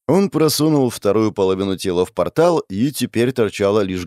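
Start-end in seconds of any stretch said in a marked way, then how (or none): none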